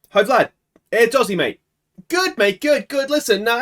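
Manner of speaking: high, lively matey greeting; chummy manager greeting. Acted. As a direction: greet your player brightly, pitched well up. Acted chat with player Vlad.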